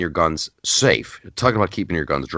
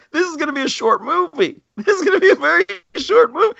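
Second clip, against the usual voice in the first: much higher, giggling through the words; laughing disbelief; faster than usual. Retold or imitated. Host laughing at the movie premise, high giggly voice. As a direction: squeal it out between giggles. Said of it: "I'm like".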